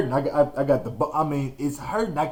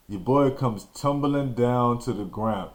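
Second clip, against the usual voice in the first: ordinary talk, nothing marked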